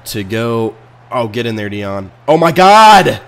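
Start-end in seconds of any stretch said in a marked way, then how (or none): 2.3-3.2 much louder, higher cry of shock; real shocked yell; shocked outburst. Real, not acted. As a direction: blurt it as the play collapses. Another disaster in game.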